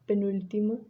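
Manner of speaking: ordinary talk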